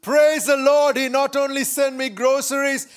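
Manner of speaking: high, loud, jubilant exclamation; overjoyed. Acted. Lady jumping for joy over groceries, high and exultant. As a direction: crow it out with joy, high-pitched. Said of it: "jumping up and down for joy".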